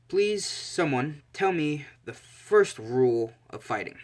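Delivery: deliberate instructor. Acted. Combat teacher quizzes his students, slow and deliberate. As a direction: draw the question out for the whole class.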